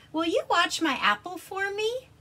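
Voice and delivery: high, loud polite dragon; pleading dragon. Acted. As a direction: ask kindly as Dragon. Dragon voiced in story.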